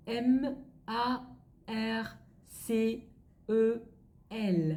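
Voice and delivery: slow French letter names, spaced apart; slow spelling. Shown as a demonstration. Teacher dictating French names to learners, slow letter by letter. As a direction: spell it out slowly, pausing after each letter. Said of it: "Chị sẽ đọc chậm thôi ạ".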